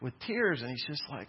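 ordinary talk

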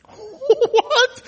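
very high, squeaky disbelieving "What?"; incredulous what. Pretend. Teacher reacting to Pilate's verdict with mock disbelief. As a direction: act baffled by the verdict.

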